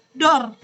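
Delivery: "dor" short, falling sharply; fourth tone. Shown as a demonstration. Teacher showing the class tone four, "dor" in fourth tone. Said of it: "Nada empat".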